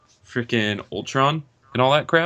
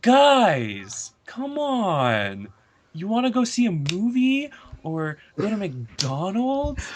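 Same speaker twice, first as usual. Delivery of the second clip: higher, slow, casual mock-villain voice; goofy Ultron impression; 9 semitones higher, much slower than usual. Mocking Ultron's jokey villain in a higher, slow goofy voice. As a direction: play the villain as a chummy buddy inviting friends out.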